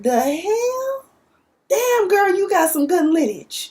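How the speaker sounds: high, startled disbelief; a high, impressed double take; amazed. Retold or imitated. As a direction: gasp at the famous family tree. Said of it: "I was like".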